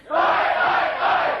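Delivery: slow, loud, high crowd call; crowd shout. Shown as a demonstration. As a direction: bellow it like a terrace crowd. Crowd chant response.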